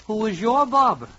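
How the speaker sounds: normal talking voice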